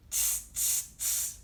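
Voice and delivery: three sharp "tss" hisses; unvoiced hissing monkey chatter. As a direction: mimic cheeky monkeys going tss. Imitates the monkeys' noise.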